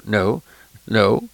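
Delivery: ordinary delivery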